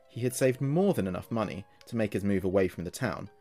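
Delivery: usual speaking voice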